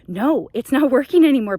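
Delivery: loud, laughing exasperation; laughing loudly that it's failing; laughing outburst. Real, not acted. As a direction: burst out laughing at the absurdity. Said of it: "people, because".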